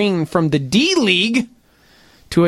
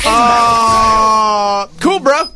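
clueless player; 5 dB louder, much slower than usual. Acted. Imitating the player finally getting it, mock clueless. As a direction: drag out the hesitation like a dim player. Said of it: "So he was like".

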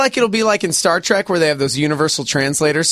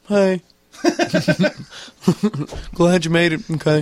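silly Jesus voice. Pretend. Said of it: "what if he did sound like that".

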